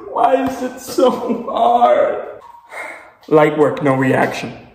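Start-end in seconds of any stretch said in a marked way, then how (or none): 0.1-2.4 high, slow pained whine; anguished whine. Real, not acted. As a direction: whine it out in agony. Struggling with hot sauce.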